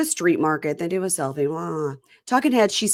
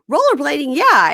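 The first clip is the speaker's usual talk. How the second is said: higher, louder eager answer; eager misunderstanding; 8 semitones higher, 8 dB louder than usual. Retold or imitated. Woman mishears the question, louder eager reply. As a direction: blurt it out happily, missing the point.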